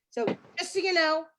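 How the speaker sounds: ordinary talk, nothing marked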